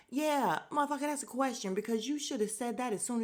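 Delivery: loud, high, fast retort; loud angry comeback; heated rant. Real, not acted. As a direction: snap back hard and fast. Ranting answer to Tommy.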